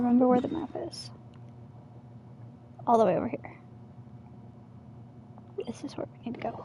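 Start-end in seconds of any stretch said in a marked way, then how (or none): 5.7-6.7 soft unvoiced whisper. Real, not acted. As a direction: breathe it in a whisper. Whispered aside.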